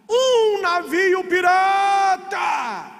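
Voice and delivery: long-drawn, high-pitched cry; lookout's shout. Retold or imitated. Lookout warns the crew from up high, drawn-out shout. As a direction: stretch every word out like a lookout's hail.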